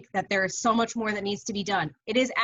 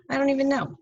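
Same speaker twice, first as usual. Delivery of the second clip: higher and a bit louder, throwing up her hands; exasperated disbelief; 6 semitones higher, 4 dB louder than usual. Real, not acted. Teacher telling her class she has lost track, exasperated. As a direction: pitch it up in baffled disbelief.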